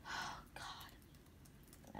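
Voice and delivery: barely audible, voiceless sigh of words; tearful whisper. Real, not acted. Reader gathering herself after nearly crying, whispered. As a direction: whisper it, overcome. Overcome, pausing to hold back tears.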